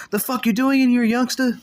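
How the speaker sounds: loud, high-pitched scolding shout; shouting the youngster down; angry yelling. Retold or imitated. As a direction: bark it like a furious older friend who caught you. OG bursts in, catches him smoking.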